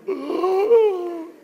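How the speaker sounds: sharp high gasp of shock; high fright gasp; startled gasp. Retold or imitated. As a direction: gasp like you just got caught. Teen startled by angry father.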